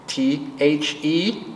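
slow, letter by letter; spelling out. Shown as a demonstration. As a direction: spell each letter for the child. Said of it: "that spells the".

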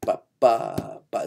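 short, long, short on "ba"; syncopated rhythm syllables. Shown as a demonstration. Teacher showing where the notes fall, rhythm chanted on ba. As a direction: tap out the off-beat figure with your voice. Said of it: "a note on and of two".

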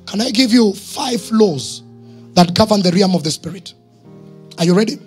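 normal talking voice

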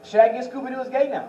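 normal talking voice

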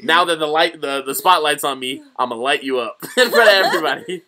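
high, loud mock threat, laugh creeping in; accusing goody-goody student. Host plays student exposing classmate before everybody, loud and accusing. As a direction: relish the public telling-off at full volume. Continues student's public telling-off.

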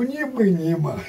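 ordinary talk

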